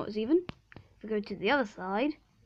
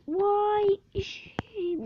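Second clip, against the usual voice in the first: sing-song call, pitched up; calling out higher; 10 semitones higher than usual. Real, not acted. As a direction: call it high like a singsong. Calling out for a sheep.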